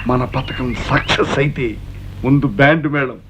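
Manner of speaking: low, measured plotter's voice; scheming. Acted. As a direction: spell out the plan, pitched low. Schemer pictures success. (కుట్ర పన్నుతున్న స్వరం)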